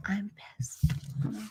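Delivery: quick whispered aside; irritated whisper. Real, not acted. Streamer whispers her irritation to chat. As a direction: mutter it unvoiced.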